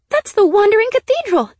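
high, loud burst of excitement; excited exclamation. A narrator's reading. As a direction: blurt out the thrilling discovery. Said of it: "she said excitedly".

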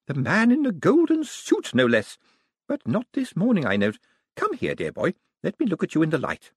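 put-on high old lady voice; old lady, teasing. A narrator's reading. Old lady inspecting Moist, high and teasing. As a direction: tease him fondly. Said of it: "said Missus Lavish".

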